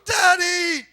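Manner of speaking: put-on high, loud child's cry of Daddy; child's wailing cry. Little daughter wails for her daddy at the school door, loud cry. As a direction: wail it like a clingy little girl.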